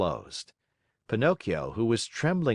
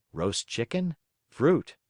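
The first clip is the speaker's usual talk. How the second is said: ordinary delivery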